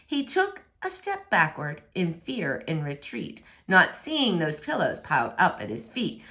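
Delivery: usual speaking voice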